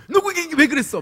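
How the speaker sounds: high, sharp mock scolding of the kids; a father snapping at his children; scolding dad. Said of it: "막 이렇게 혼내고 있는 아빠가". (아이를 혼내는 아빠 흉내)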